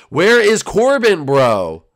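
loud, drawn-out demand; exasperated shout. Real, not acted. Gamer searching for missing Corbin, exasperated loud call.

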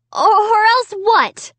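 high, loud, slow stammered cry. A narrator's reading. Child challenging the dummy who grips her arm, crying out. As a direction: throw back a high defiant cry. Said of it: "I cried".

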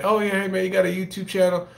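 a hint of an eager fan spotting a YouTuber; eager stranger. Stranger on the street asks about the channel, a hint of eager fan. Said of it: "If this motherfucker said".

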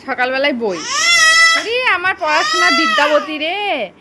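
playful fake lament, voice crying up high; mock wailing. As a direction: wail it like a teasing lament. Mock lament over child's book.

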